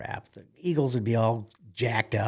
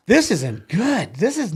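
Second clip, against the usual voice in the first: alarmed thought; 8 semitones higher, 5 dB louder, slower than usual. Retold or imitated. Dismayed inner thought.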